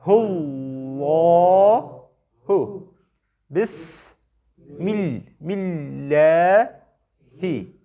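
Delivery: drawn-out Quran recitation pace; tajweed recitation demo; slow recitation. Shown as a demonstration. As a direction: recite each word slowly and melodically. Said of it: "तो पढ़ के सुनाएं".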